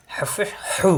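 হু breathed from the throat; throat pressure. Shown as a demonstration. Teacher showing the throat sound, breathy. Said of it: "কণ্ঠ হলতে চাপ দিয়ে".

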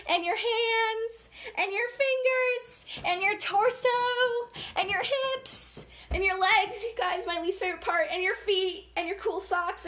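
high, bouncy cheerleader voice; excited countdown. Acted. Leading children through wiggles, high and excited. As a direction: pump the kids up as you count down.

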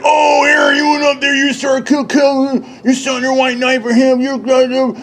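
high, whining mock voice with a shout; mimicking a friend as a whiny crybaby, high and mocking; mocking whiny quote. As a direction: whine it back at him like a crybaby. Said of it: "Cruz was like".

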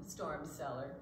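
plain reading voice

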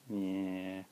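ordinary talk, nothing marked

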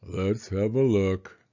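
low, very slow mournful voice; gloomy donkey. Acted. Eeyore doubts Pooh, slow and gloomy. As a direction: drag it out like a weary old donkey.

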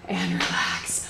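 breathy whisper to relax. Real, not acted. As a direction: whisper the cue softly. Coach cools class down.

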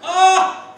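loud, high "ah" burst; sudden waking yell. Acted. Paralysed sleeper gets hit awake, sudden yell. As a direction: cry out as if jolted free.

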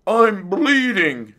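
high, slow, wailed yelp. A narrator's reading. Fox waking after the extraction, yelping. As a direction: cry out in stretched-out alarm. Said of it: "the fox yelped".

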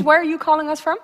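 ordinary talk, nothing marked

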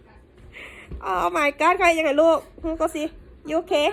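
ordinary delivery

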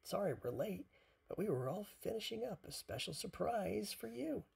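high, lively character voice; high storybook duck voice; eager Duck. A narrator's reading. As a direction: pitch it up like an excited little duck. Duck arrives, announces a surprise.

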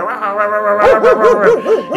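rapid, high, loud barks; imitated dog barking. Narrator mimics his dog going wild at the road, imitated barking. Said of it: "Empezó a ladrar".

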